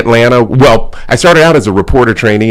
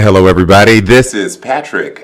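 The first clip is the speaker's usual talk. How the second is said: radio broadcast voice; 4 semitones lower than usual. Shown as a demonstration. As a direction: introduce yourself like a smooth broadcaster. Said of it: "give it my radio broadcast".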